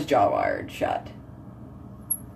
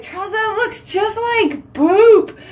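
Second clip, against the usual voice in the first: high, slow, laughing through it; laughing quote; 12 semitones higher, slower than usual. Retold or imitated. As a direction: squeal it out while cracking up. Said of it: "I just started laughing".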